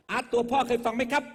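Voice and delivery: high, loud crowd hype call. Acted. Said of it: "หนักแน่นหน่อยครับ ผมดังครับ".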